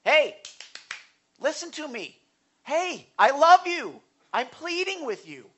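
high, loud cry of wake up, then pleading; pleading call. Acted. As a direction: call out to a people who won't hear, begging them. God calling to unhearing people.

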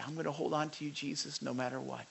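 hushed earnest vow to Jesus; quiet vow of trust. Retold or imitated. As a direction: murmur it as a faithful promise. Voicing a trusting believer.

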